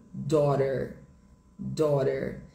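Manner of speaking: American "daughter", tapped T and sounded R; sotaque americano. Shown as a demonstration. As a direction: say "daughter" as "daw-der", American. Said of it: "no inglês americano fica". (sotaque americano, T batido e R final)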